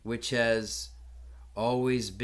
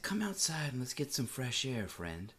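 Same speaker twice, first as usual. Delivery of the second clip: gentle comfort; 9 dB quieter than usual. Retold or imitated. Comforting a weeping stranger.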